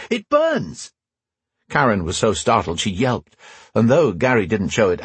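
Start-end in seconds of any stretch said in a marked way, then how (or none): 0.1-0.9 high, sudden yelp of pain; fake pain scream. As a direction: shriek it as if burning. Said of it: "jerked suddenly and screamed".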